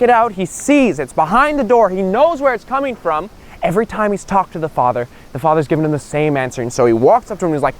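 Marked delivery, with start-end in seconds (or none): none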